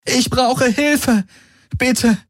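high, drawn-out plea for help; calling out. A narrator's reading. Kirai to the few warriors still standing, calling out desperately. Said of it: "rief sie den wenigen Golvonen zu".

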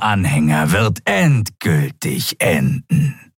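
slow, menacing prophecy of doom; fanatical reptile captive. Acted. Captive reptile prophesying the regime's end, fanatically. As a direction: swell into a zealot's threat, then sink.

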